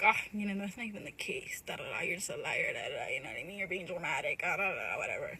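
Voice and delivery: low, dismissive mock of the friend; mocking the friend who called her a liar. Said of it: "she's like".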